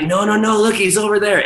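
imitated staff in high, hurried protest; urgent placating. Club staff stopping DJ from cutting music, urgently placating. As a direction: mimic someone heading off a threat, pitched well up. Staff calming DJ, pointing out friend.

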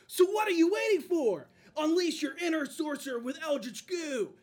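toy commercial announcer. Pretend. Mock toy commercial continues.

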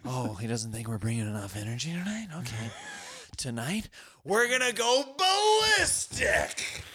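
rally the troops, then stretch ballistic; hammed-up pep talk. Firing up the cast before the show, building to a hammed-up roar. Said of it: "I don't know where it came from".

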